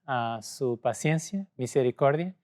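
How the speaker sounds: normal talking voice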